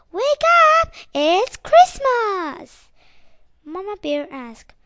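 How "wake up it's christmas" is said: very high, loud and drawn out; shouting. Acted. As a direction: shriek it with delight. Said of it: "Sadie Bear wakes up and shouts".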